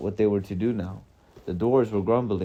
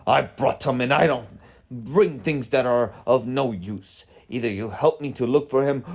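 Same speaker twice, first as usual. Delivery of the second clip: raised, emphatic scolding in character; heated storybook rebuke, higher and forceful; angry wizard; 4 semitones higher, 5 dB louder than usual. A narrator's reading. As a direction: tell them off as an indignant wizard. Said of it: "Gandalf answered angrily".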